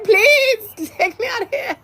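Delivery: very high squeaky plea through laughter; comic high-pitched begging; mock pleading. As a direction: beg in a tiny high voice, half laughing. Mock plea to be taken away.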